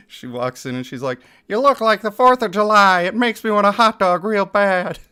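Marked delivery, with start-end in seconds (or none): 1.5-5.0 loud, higher movie-character impression; high, loud put-on actress voice; Jennifer Coolidge. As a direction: belt it out as a quoted comedy line. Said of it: "Jennifer Coolidge from Legally Blonde two".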